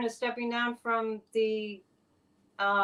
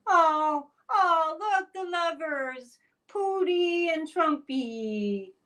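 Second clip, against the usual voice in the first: high sing-song mock delight over the lovers; mock gushing; 6 semitones higher, slower than usual. Real, not acted. Host mocking a photo of two leaders, sarcastic gushing.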